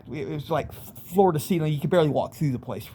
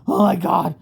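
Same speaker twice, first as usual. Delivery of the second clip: fearful gasp; 3 semitones higher, 6 dB louder than usual. Retold or imitated. Cornered by demons in alcove.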